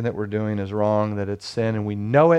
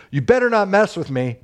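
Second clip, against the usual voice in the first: quoting his son; 7 semitones higher than usual. Retold or imitated. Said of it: "one of my sons say".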